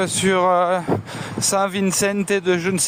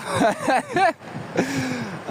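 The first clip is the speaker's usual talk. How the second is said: laughing at his own joke, up high; high delighted laugh; 9 semitones higher than usual. Real, not acted. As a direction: let out a bright high laugh. Laughs after the preacher joke.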